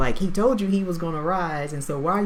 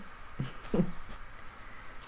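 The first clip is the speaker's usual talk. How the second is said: soft breathy laugh; 11 dB quieter than usual. Real, not acted. Sheepish laugh at own unpreparedness.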